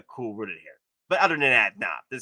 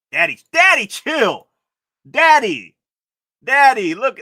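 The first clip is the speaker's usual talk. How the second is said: high, loud hyped shouting; excited shouting; 9 semitones higher, 11 dB louder than usual. Real, not acted. Streamer shouting delight at the Loki figure, high and loud. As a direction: yell out in delight.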